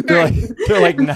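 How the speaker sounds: high, loud laughing through the words. Real, not acted. Host teasing both guests mid-laugh, laughing. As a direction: crack up while teasing them.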